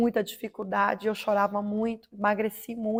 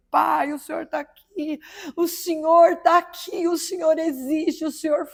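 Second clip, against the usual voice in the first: higher, crying out that he is here; ecstatic cry; 5 semitones higher, slower than usual. Retold or imitated. Kneeling woman crying out to God in rapture. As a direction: exclaim in awe and joy. Overwhelmed by God's presence.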